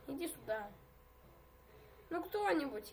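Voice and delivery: normal talking voice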